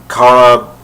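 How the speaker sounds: Kara said "KAH-ruh"; KAR-uh pronunciation. Shown as a demonstration. Fan recalls the Smallville exception, Kara as KAH-ruh. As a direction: open "ah" in Kara, as in car. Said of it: "except for one instance".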